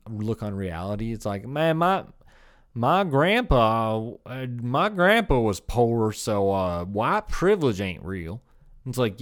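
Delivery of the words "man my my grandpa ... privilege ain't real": slow, loud hammed-up Northeast Texan drawl; mock Texan accent. Stupid person denying his privilege, in a mock Texan drawl. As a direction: mimic a dumbass with a drawn-out southern accent. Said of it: "a Northeast Texan accent".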